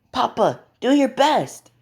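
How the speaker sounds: put-on very high, childlike cheer for papa; little girl cheering. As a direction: squeak encouragement like a small girl.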